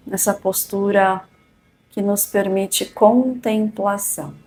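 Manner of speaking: calm and unhurried, long pauses; soothing spa whisper. Acted. Leads a guided meditation.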